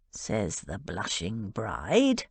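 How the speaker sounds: low, slow, dry male jab; sardonic. A narrator's reading. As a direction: drawl it out with mock sarcasm. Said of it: "Cal said sardonically".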